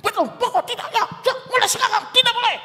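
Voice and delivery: high and rising to a shout with a laugh; mock furious parent cutting the child off; hasty angry parent. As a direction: win the argument in seconds. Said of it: "Pingin langsung debat".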